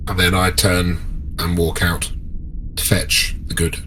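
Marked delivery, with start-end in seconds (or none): none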